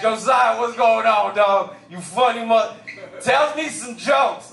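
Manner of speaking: put-on high, excited greeting from the boys; boys' hype greeting. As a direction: hype him up like one of the boys. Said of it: "my boys being like".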